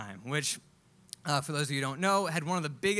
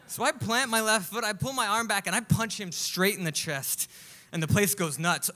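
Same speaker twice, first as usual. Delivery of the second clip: ordinary talk, nothing marked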